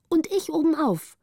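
put-on high-pitched child calling out; eager call. A narrator's reading. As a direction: pipe up eagerly, voice lifted high. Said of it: "rief Petrina". (eifriger, heller Ausruf)

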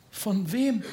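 timid. Acted. Oliver asks who the baron means, timidly. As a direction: ask hesitantly, a touch timid. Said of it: "fragte Oliver zaghaft".

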